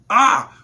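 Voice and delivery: a sudden loud, high yell of pure hype; excited shout. Real, not acted. Vlogger hyped about his school's win, excited yell. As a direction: let out a burst of joy. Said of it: "really got me like".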